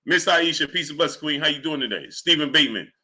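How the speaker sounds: normal talking voice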